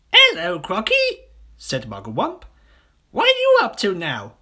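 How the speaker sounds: very high, loud cheeky voice; chirpy monkey. Acted. Monkey greets the crocodile, squeaky and cheeky. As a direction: chatter it up high.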